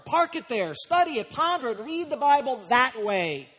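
high-pitched, insistent pulpit exhortation; impassioned preaching. Real, not acted. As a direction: drive each question home to the congregation. Preaching at full force.

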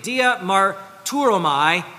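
"dia marturomai" spoken slowly, syllable by syllable; slow, careful "dia marturomai"; Greek term. Shown as a demonstration. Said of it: "That, that word in Greek".

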